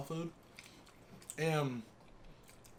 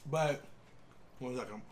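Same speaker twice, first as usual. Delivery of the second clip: talking with a mouthful; words around a bite of chicken; mouth full; 3 semitones lower, 3 dB quieter than usual. Real, not acted. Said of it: "Something hard in my mouth".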